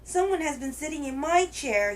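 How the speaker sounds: high, wee little cub voice; Baby Bear. A narrator's reading. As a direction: protest in a tiny bear's high voice. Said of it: "in his wee little voice".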